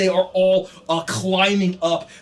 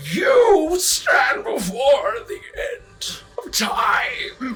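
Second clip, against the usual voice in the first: very slow, much higher, shouted and echoing; booming demon voice; 7 semitones higher, much slower than usual. Acted. Pazuzu proclaiming doom to the heroes, booming. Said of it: "the voice of Pazuzu".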